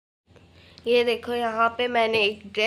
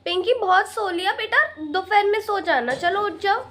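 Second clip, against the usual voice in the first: calling to wake up; 7 semitones higher, 4 dB louder than usual. Acted. Mother waking daughter on festival.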